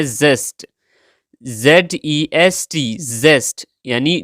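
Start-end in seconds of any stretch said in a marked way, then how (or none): 1.4-3.0 slow letter-by-letter spelling; spelling aloud for learners; spelled out. Shown as a demonstration. Spelling out the word.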